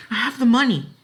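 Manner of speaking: put-on high, loud girl's urging; insistent high plea. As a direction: press him to agree. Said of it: "Ellen urged him".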